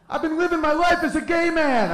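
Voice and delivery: pleading rooster. Retold or imitated. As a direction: plead for his life in a squeaky rooster voice. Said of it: "he's like".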